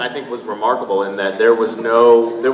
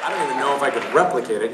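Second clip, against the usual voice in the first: ordinary talk, nothing marked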